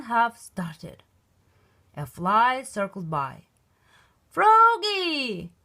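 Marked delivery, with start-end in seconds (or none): 4.3-5.5 high loud call of the name. Acted. Coach calling Froggy across the field, loud call. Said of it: "called the coach".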